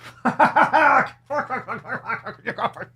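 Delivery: high-pitched cackle; high giggling laugh. Real, not acted. Podcaster laughing at an absurd tweet, high cackle. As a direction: burst out in a long high laugh.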